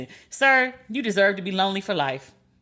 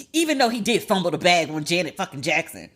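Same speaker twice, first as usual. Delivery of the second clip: ordinary talk, nothing marked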